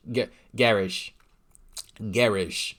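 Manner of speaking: garage said the Canadian way, "garage" as GAIR-ish; mock Canadian "garage" with a short ish ending. Shown as a demonstration. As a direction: imitate a Canadian saying "garage". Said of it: "That's how they say it".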